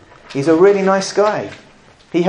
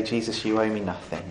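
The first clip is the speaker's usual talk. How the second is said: humble quiet plea; 5 semitones lower, 11 dB quieter than usual. Shown as a demonstration. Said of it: "Or does it come to him and say".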